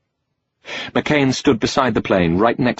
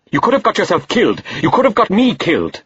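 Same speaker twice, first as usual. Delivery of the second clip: angry shout; 10 semitones higher than usual. Acted. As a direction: shout him down in rage. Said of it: "He still had to shout".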